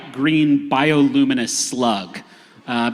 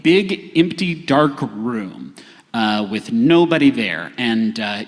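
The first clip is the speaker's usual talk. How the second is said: ordinary talk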